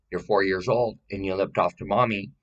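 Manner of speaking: usual speaking voice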